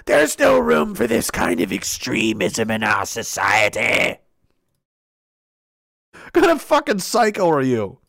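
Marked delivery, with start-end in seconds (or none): 0.1-4.2 very low, growled pronouncement; mock villainous official, pitched way down; pompous authority figure. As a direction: pronounce judgement in a deep put-on voice. Acting pompous authority condemning the questioner.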